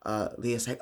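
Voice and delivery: ordinary talk, nothing marked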